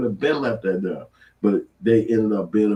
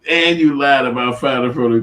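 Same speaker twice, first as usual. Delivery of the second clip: loud laughing disbelief; 5 semitones higher, 6 dB louder than usual. Real, not acted. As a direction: laugh it out loudly. Laughing at the testimony.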